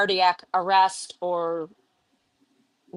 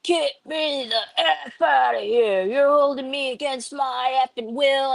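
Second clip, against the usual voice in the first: angry patient; 6 semitones higher than usual. Retold or imitated. Patient yelling at nurses to be let out, angry mock yell. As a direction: rant at the nurses like the furious patient. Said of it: "he's like".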